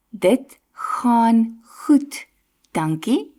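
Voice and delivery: Dit gaan goed, dankie said very slowly for learners; slow clear demo. Shown as a demonstration. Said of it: "put it into real sentences".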